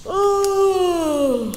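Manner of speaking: high eerie ooh; spooky ooh. Acted. Narrator making ghostly night sounds, eerie. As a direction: wail it like a ghost.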